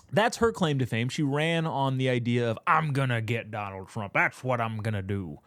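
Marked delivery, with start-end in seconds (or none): 2.7-5.4 a lightly put-on voice for the attorney general; mock her campaign promise in a slight caricature voice; Letitia James voice. Vlogger mocking the attorney general's campaign pledge, lightly put-on voice. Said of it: "I don't know why I gave her that voice".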